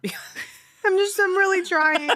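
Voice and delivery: half laughing, half crying; teary laugh-crying. Real, not acted. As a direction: voice cracking with emotion. Emotional, fanning her teary eyes.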